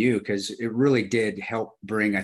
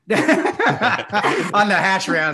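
laughing through the words; slow, loud, laughing; 9 semitones higher, 8 dB louder, much slower than usual. Real, not acted. Laughs at choking friend.

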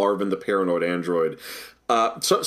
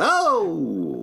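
put-on Brooklyn accent; 5 semitones higher than usual. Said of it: "it should have been from Brooklyn".

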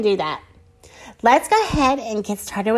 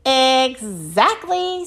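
slow, stretched-out EXACTLY; drawn-out agreement; 3 semitones higher, much slower than usual. Acted. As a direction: draw the word out to make a point. Minnie answers Moo pointedly.

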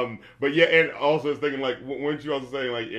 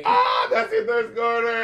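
higher, slow, laughing teasing; laughing tease; 10 semitones higher, 5 dB louder, slower than usual. Real, not acted. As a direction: poke fun at a friend's crush through laughter. Teasing friend about crush.

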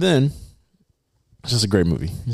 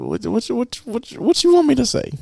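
high, startled and flustered. Real, not acted. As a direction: caught off guard, pitch leaps up. Flustered, pitch jumps up.

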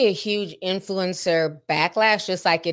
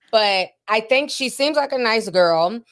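ordinary talk, nothing marked

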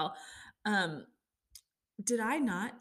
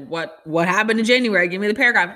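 exasperated demand; 10 dB louder than usual. Retold or imitated. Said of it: "And it's like".

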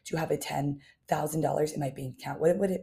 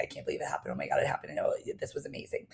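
whispered disbelief; 6 dB quieter, faster than usual. Retold or imitated. Acted excited self-quote.

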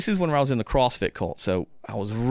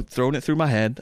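ordinary delivery

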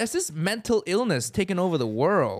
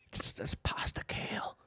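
whispered mock misery; whispering; 13 dB quieter than usual. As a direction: breathe it as a sad secret. Mocking sad vegan meal.

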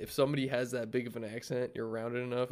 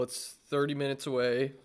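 ordinary delivery

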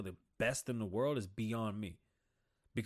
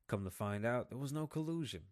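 ordinary delivery

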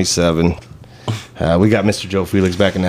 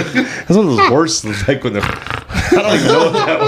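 giggling through the words, higher; can barely talk for laughing; 9 semitones higher than usual. Real, not acted. Laughing over the snort.

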